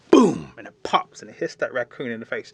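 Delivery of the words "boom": sudden loud, high "boom"; imitated fire pop. A narrator's reading. Storyteller imitates the fire popping, explosive boom. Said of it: "it starts to pop like fires do".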